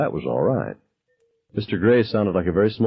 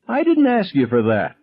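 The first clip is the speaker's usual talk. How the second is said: sharp high yell, much higher; screamed; 12 semitones higher, 3 dB louder than usual. Acted. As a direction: scream him down mid-answer. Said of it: "I screamed".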